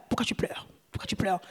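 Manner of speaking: breathed, unvoiced question to Jesus; hushed whisper. Shown as a demonstration. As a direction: whisper it tenderly, almost to yourself.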